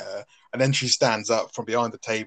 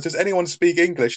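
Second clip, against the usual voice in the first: higher, lightly voiced girl; the German girl; 8 semitones higher, 5 dB louder than usual. The German girl asking the bar for help, higher. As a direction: ask it plainly in a slightly higher voice. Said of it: "she turns around and goes".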